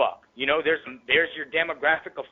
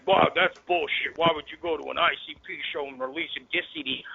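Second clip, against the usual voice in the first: mocking fans; 3 semitones higher than usual. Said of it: "Juggalos wanna ask".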